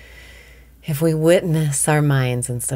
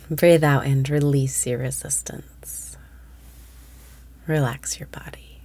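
whispered meditation. Acted. Meditation cues continue.

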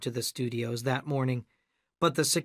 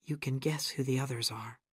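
quiet; 8 dB quieter than usual. A narrator's reading. Gale confides who the others are, quietly. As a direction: say it softly, under the voice. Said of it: "she said quietly".

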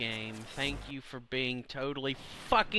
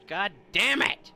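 louder, higher outburst; angry curse; 6 semitones higher, 6 dB louder than usual. Real, not acted. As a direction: spit the curse out in anger. Rage at game.

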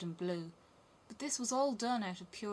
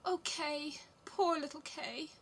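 put-on high, plaintive little girl; sighing; 8 semitones higher, slower than usual. A narrator's reading. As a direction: sigh it out with pity for a lost friend. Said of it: "sighed Gerda".

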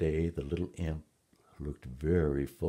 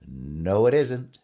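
loud denial swooping upward; exultant storybook imp; gleeful imp; 4 semitones higher, 9 dB louder, slower than usual. A narrator's reading. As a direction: crow it louder, rising high in triumph. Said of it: "laughing and twirling its tail".

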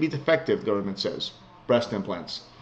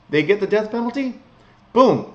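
usual speaking voice

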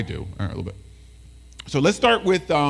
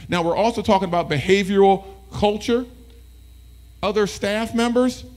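ordinary talk

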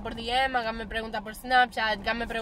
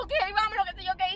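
retold hurry; 9 semitones higher, faster than usual.

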